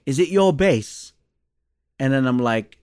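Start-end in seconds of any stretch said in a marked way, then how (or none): 0.1-1.1 lightly imitated British accent, drawn out; a hint of British accent, higher and slower. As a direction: raise the pitch for a slow British imitation. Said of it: "he has this British accent".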